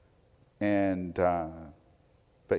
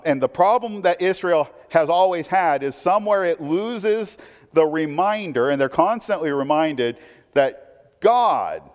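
higher, louder preaching, slowing on key phrases; fiery preaching; 6 semitones higher, 5 dB louder than usual. Acted. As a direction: drive the point home from the pulpit. Preaching with rising force.

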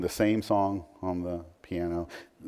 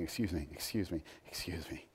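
much softer, lower, trailing off; softer mock pleading; 4 semitones lower, 13 dB quieter than usual. Us chasing God round the house, softly mocked pleading. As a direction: murmur it like a tired repeated plea.

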